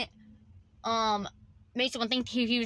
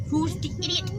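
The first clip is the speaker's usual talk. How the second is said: voiced dog; 7 semitones higher than usual. Voices the dog barking at owner.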